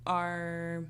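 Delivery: usual speaking voice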